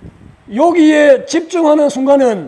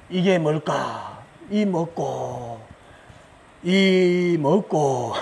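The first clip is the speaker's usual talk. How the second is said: very slow, soft, drawn-out contemplation; meditative questioning; 7 semitones lower, 7 dB quieter, much slower than usual. Shown as a demonstration. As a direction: draw each word out low and slow, pondering. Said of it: "요렇게 하라고요".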